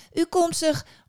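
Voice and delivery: put-on high, loud girl; girl's voice. A narrator's reading. Girl to the bitter woman, high-voiced. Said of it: "zei het meisje".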